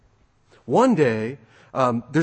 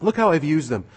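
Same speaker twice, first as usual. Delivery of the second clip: proud servant bursting to show his work; excited. Acted. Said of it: "he's excited".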